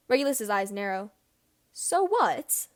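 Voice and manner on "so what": higher, mocking echo. Regulus echoes James, almost mocking. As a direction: throw the words back with scorn. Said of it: "he repeats almost mockingly".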